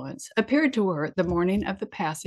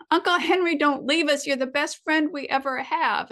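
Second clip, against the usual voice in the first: crying wildly; 7 semitones higher than usual. A narrator's reading. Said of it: "she cried wildly".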